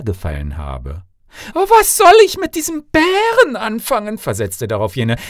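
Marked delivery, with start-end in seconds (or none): 1.5-4.2 high, loud indignant princess; haughty dismissal, pitched very high; scornful outrage. Acted. Princess rejects second suitor.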